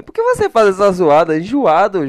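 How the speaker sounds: loud, high-pitched scolding; mock viewer complaint. Annoyed viewer complaining to the streamer, mock loud scolding.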